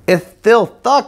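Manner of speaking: thuck, said with a lisp, th for s, high and slow; lisping little girl. A narrator's reading. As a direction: lisp it out through a loose tooth, up high. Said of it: "showing Doctor Bearson her loose tooth".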